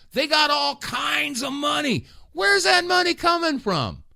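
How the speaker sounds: indignant shouted demand; outraged shout. Real, not acted. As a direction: yell it like you can't believe it. Ranting about money in Kyiv.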